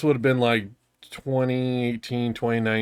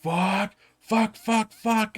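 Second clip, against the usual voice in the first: higher, half-hearted imitated rage yells; mild mock yell; 8 semitones higher, 3 dB quieter, much slower than usual. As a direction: imitate the friend yelling, pitched up but not loud. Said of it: "he yells loud".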